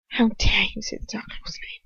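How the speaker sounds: hushed icy aristocrat, breathed out; a sneering nobleman whispering down at her; cold whispered sneer. Acted. Lucius outraged, whispered.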